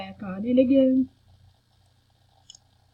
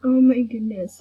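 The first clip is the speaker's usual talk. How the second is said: ordinary delivery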